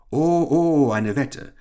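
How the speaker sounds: high, drawn-out "oh, oh"; impressed exclamation. Acted. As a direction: react as if newly impressed. Engländer hört von der Wette.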